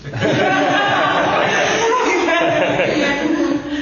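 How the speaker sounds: risada longa, aguda e alta; gargalhada alta. Real, not acted. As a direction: explode de rir. Gargalhada sobre o filho. (gargalhada longa e aguda)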